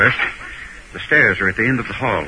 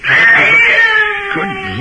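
startled cry; 7 dB louder than usual. Acted. A scream heard in the house.

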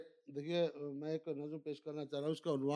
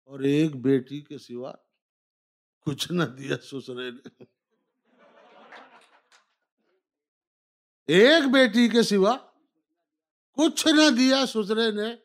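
measured, declaimed verse lines; stately nazm recitation; 6 semitones higher, 13 dB louder, slower than usual. Acted. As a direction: recite each line, then repeat it louder. Recites nazm Aisi Taisi.

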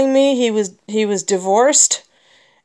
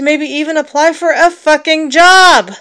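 exasperated outburst; 7 semitones higher, 7 dB louder than usual. Real, not acted. Angry vent about job hunting.

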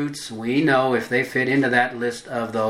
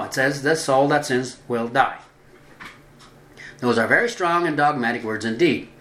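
ordinary talk

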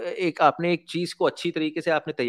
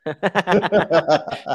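bright burst of laughter; laughing; 6 semitones higher, 6 dB louder than usual. Real, not acted. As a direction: laugh out loud. Host laughs at the joke.

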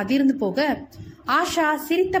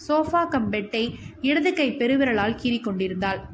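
ordinary delivery

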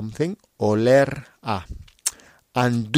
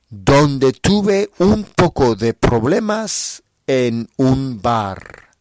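a lively diary-story read aloud for Spanish learners; story narration; 3 semitones higher, 4 dB louder than usual. Acted. Teacher reads a graded story to listeners, lively narration. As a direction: tell the trip story clearly and with energy.

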